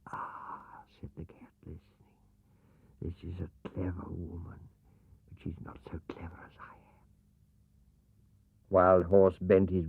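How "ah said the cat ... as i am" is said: whisper. Acted. Cat listening in hiding.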